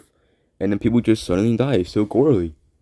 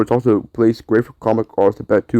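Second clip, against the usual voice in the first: ordinary talk, nothing marked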